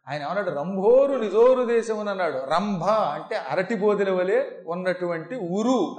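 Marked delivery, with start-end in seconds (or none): 0.5-2.1 loud declaimed verse; booming mythological stage delivery; ringing heroic threat. Shown as a demonstration. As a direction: proclaim it like a warrior's vow. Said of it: "ఆ కంఠంలో ఝంకారం కనపడాలి".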